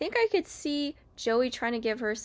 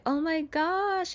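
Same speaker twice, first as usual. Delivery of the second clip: high, drawn-out awestruck gush; Joey; 7 semitones higher, slower than usual. Retold or imitated. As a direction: imitate him swooning, slow and pitched up. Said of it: "Joey's like".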